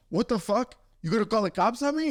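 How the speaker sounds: high, quick mock outrage; pissed-off dad. Dad reacting to animal control call, retold pissed off. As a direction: fire it off indignant, pitch up. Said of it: "he got all pissed off about it".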